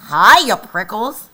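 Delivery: loud, friendly shout; cheerful shout. Acted. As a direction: call out a teasing hello. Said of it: "she shouted".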